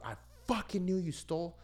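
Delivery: high-pitched imitation of a furious father; angry dad. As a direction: act out dad catching his son stealing. Retells angry dad catching him stealing.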